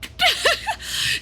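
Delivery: high, squeaky laugh; burst of laughter. Real, not acted. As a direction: break into high, breathless giggling. Laughs at own realisation.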